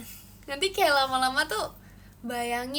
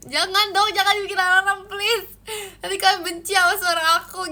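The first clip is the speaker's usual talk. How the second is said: loud pleading; 6 semitones higher, 10 dB louder than usual. Real, not acted. Pleading with viewers not to clip.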